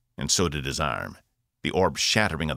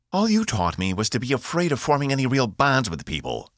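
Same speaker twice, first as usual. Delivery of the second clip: higher, raised voice in protest; yelled; 8 semitones higher than usual. A narrator's reading. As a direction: shout it back at him. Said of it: "she yelled".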